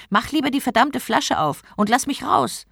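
impatient demand. A narrator's reading. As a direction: demand to be let out. Annoyed genie demands to be freed. (ungeduldig fordernd)

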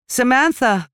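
high, loud call of the full name. A narrator's reading. Mother calling Samantha from the kitchen, a loud high call. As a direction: call her daughter from the kitchen. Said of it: "rief Gemma".

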